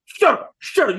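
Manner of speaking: hammed-up shout; mock yell. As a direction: bark it like the film silencing you. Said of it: "The movie snatches you".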